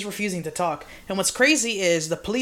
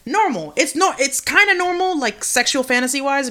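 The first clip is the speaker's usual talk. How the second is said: emphatic insistence; 6 semitones higher, 6 dB louder than usual. Real, not acted. Insisting kink is normal.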